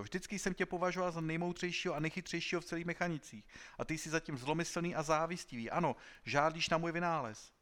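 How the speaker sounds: high-pitched, aggrieved tirade; accusing outcry. A narrator's reading. Inventor accusing his idol of jealousy, crying out. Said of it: "vykřikl Klorindo".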